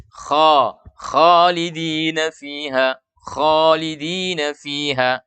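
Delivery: slow, drawn-out "khalidina fiha" with heavy KHO; thick unpouted kho. Shown as a demonstration. Teacher recites the correct verse reading, thick kho. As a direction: stretch "kho" thick without pouting. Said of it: "Tebal tapi tidak monyong".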